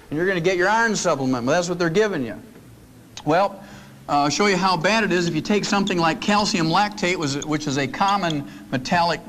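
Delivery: ordinary delivery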